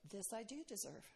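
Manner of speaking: hushed and resigned, nearly to herself; grim acceptance. Retold or imitated. New mother bleeding after birth, telling herself, hushed. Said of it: "And I'm like".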